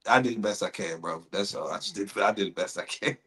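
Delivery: low, soft, deflated reply; sheepish excuse. Acted. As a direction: mumble an excuse to the coach. Acted player excuses failure to coach.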